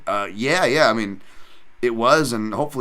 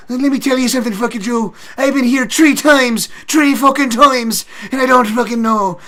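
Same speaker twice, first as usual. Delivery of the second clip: McGregor; 10 dB louder than usual. Retold or imitated. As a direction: rant like a coked-up Irish braggart. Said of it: "he does this, uh".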